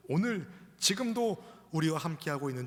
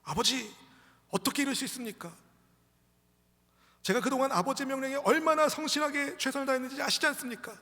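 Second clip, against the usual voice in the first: high, indignant protest to a father; acted fury, pitched way up; angry son; 12 semitones higher, 5 dB louder than usual. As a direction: plead your case in rising outrage. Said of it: "이 아들은 분노하는 겁니다".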